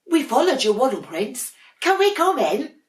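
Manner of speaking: very high, sing-song little voices; sweet sibling penguins. Acted. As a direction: coo it like cuddly little penguins. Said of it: "said two of Dudley's sisters".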